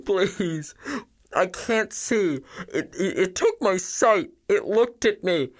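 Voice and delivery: high, slow tearful wail; terrified survivor breaking down in tears; sobbing. Acted. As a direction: sob out the account, begging. Blinded survivor sobbing out his story.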